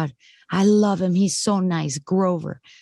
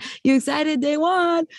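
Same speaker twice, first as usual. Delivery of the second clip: chirpy encouragement; 8 semitones higher than usual. Retold or imitated. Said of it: "I was like".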